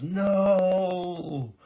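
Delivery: very high, loud mock protest; squeaky no. Shadowheart reacts to the king's killing, squeaky and loud. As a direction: yelp the no in a silly high voice. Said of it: "And Shadowheart goes".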